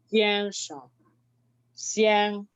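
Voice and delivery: XIANSHENG with XIAN sustained, SHENG dropped short; second syllable toneless. Shown as a demonstration. Teacher asking for attention, XIANSHENG with a light toneless ending. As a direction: hold XIAN level and let SHENG fall away. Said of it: "la pronunciamos sin ningún tono".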